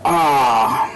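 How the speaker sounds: ordinary talk, nothing marked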